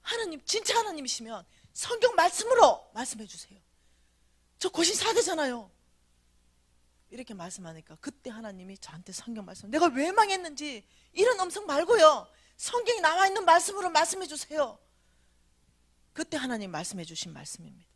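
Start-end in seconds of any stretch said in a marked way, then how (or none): 0.0-5.7 loud, high-pitched challenge for proof; insistent demand. Retold or imitated. Speaker demanding that God answer in scripture, insistent. 9.7-14.8 loud, high, forceful plea; insistent demand. Retold or imitated. Speaker rejecting the voice, asking for scripture, forceful.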